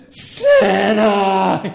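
loud, high, laughing shout of Santa; scream. Retold or imitated. Preacher retelling Elf, screaming as Buddy hearing Santa is coming. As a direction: scream the name with joy. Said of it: "he screams".

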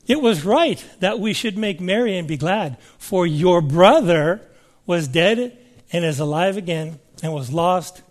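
ordinary talk, nothing marked

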